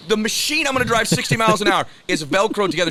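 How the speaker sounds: high, loud mock disbelief; incredulous. Buyer protesting to the seller, incredulous.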